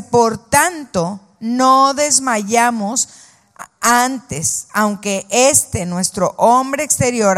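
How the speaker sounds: slow, weighty reading of the verse; solemn scripture reading. Acted. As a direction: read it out slowly with reverence. Lectura solemne del versículo. (lectura lenta y solemne)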